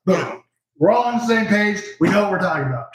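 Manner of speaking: ordinary delivery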